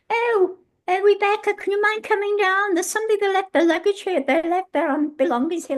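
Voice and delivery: high-pitched pleading caller; pestering guest. Retold or imitated. Guest phoning the hotel director at three in the morning, high pestering voice.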